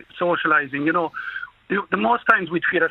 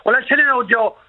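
excited; 12 semitones higher, 3 dB louder than usual. Real, not acted. Proud caller boasting about Kerry.

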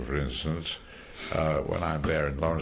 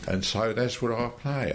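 ordinary talk

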